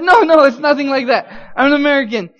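high, loud protest; hurried denial. Retold or imitated. Suspect denying he is a Russian spy, emphatic. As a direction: protest your innocence loudly.

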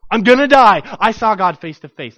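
loud panicked outburst; frantic terrified cry. Acted. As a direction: blurt it out in panic. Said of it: "He's terrified".

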